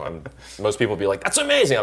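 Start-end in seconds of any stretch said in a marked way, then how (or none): 1.2-1.8 high-pitched gushing reaction; mock gushing. Imitating people thrilled about four months abroad, mock gushing. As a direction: parody an over-excited response, pitched way up. Mimics what most people would say.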